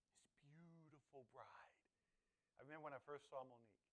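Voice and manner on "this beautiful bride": slow awed whisper. Real, not acted. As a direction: hush it in wonder. Picturing the bride.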